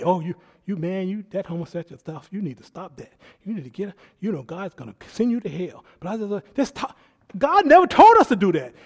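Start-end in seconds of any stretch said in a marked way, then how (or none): 0.0-5.8 mock condemning. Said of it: "and I'm condemning".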